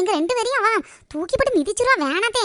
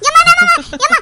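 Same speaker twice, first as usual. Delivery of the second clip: loud startled cry of an angry mother; startled angry yelling; 4 dB louder than usual. Acted. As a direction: yell out, jolted awake and furious. Woken by shouting, scolding daughters. (தூக்கத்திலிருந்து திடுக்கிட்டு எழுந்த அம்மாவின் கோபக் கத்தல்)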